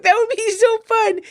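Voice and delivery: excited high. Retold or imitated. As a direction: gush like a thrilled friend. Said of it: "And I'm like".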